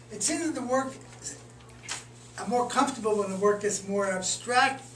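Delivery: normal talking voice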